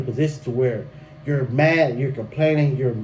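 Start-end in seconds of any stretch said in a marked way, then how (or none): none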